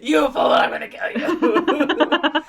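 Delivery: high, loud, slow villain voice; hammed-up evil egg; mock villain threat. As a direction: drag it out like a cartoon baddie. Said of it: "Humpty Dumpty's like".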